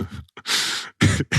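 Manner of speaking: breathy laugh breaking through; can't hold back a laugh; real laugh. Real, not acted. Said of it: "I can't do it without laughing".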